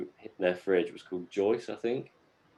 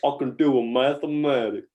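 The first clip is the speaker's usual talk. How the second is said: higher, put-on character voice; mock Deliverance impersonation; 5 semitones higher, 4 dB louder than usual. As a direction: repeat the silly boast in character. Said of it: "was that your Deliverance impersonation?".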